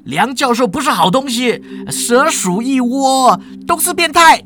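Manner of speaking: angry outburst. Acted. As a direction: build up the insults until you are shouting high. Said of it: "当场就有人开骂啦".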